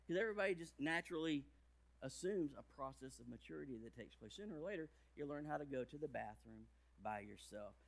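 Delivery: ordinary delivery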